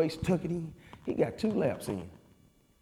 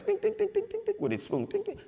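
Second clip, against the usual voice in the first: high, sung ding-ding of a spoon on glass; glass ding imitation. Veterans tapping tea glasses for entertainment, imitated high. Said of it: "they tap on their tea glass".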